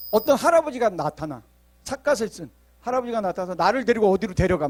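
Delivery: ordinary delivery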